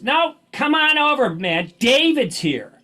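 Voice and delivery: lightly imitated friend drawing him over; insistent mock Freddie. Freddie coaxing tired Bruce to come meet David, slow high mock voice.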